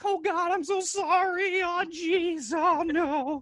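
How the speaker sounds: very high-pitched, cowering mock pleading; panicked apology. Husband replaying apology to his angry wife, high panicked pleading. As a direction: squeak out a frantic, shrinking apology.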